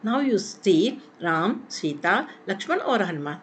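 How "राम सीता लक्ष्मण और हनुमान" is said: brief stop after Ram and after Sita; short pauses at commas. Shown as a demonstration. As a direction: list Ram, Sita, Lakshman with small breaks. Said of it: "Ram के बाद थोड़ी रुकी".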